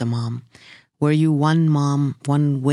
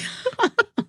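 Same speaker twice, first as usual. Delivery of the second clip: a burst of high laughter. Real, not acted. Interviewer laughs at story.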